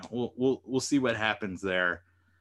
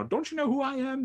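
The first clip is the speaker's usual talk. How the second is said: much higher mocking voice of a pompous soldier; arrogant self-introduction; 12 semitones higher than usual. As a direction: lampoon the self-important hero demanding recognition. Mocking John Walker's self-important introduction.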